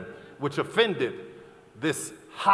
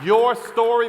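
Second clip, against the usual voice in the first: higher, louder burst; 6 semitones higher, 6 dB louder, slower than usual. Real, not acted. Passionate appeal to the audience.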